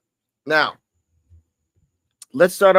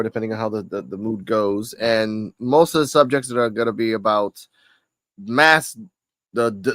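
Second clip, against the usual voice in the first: usual speaking voice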